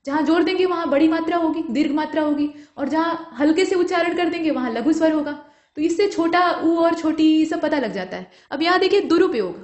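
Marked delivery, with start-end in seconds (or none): none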